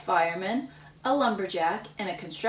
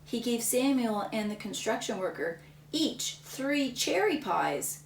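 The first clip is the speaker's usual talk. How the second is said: ordinary delivery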